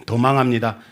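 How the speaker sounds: normal talking voice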